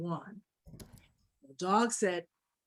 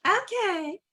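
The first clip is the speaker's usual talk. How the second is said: high-pitched cat's okay; eager cat; 9 semitones higher, 4 dB louder than usual. Acted. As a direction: agree in a high little voice. Cat takes cheese again.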